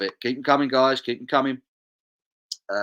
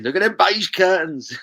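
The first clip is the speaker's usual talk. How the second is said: mock voice; 9 semitones higher, 8 dB louder than usual. Reading chat in a mock voice.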